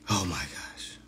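breathless whispered laugh-out; barely voiced, spent from laughing. Real, not acted. Winding down after laughing hard, whispered.

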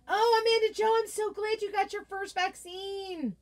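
high, gushing happy cry; delighted congratulations. Real, not acted. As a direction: squeal it, thrilled for a friend. Cheering a viewer's vaccine news.

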